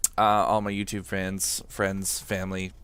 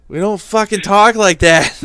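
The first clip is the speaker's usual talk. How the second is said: loud, high, slowed outburst; indignant shout; 9 semitones higher, 12 dB louder than usual. Real, not acted. Boston local fed up with fake accents, shouting. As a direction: yell it in mock outrage. Indignant repeat, much louder.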